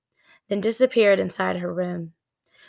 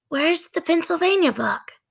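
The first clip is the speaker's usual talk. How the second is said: put-on high searching girl's voice; little girl Annie; 9 semitones higher than usual. Annie searching for the book, high girl's voice. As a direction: ask while fumbling in the dark.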